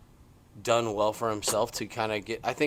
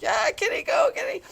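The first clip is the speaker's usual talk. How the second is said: cheering; 5 dB louder than usual. Retold or imitated. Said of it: "All us old people were like".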